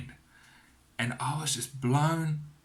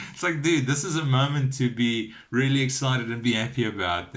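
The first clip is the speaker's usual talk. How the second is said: louder, laughing protest; amused exasperation; 3 semitones higher, 6 dB louder than usual. Retold or imitated. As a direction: urge him loudly, half laughing. Amused exasperation at brother's mild reaction.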